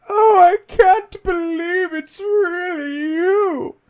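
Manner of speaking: high, slow, sobbing butler voice; a tearful old servant overjoyed to see you; weepy robot butler. Retold or imitated. Codsworth the robot butler greeting his returning owner, high and tearful.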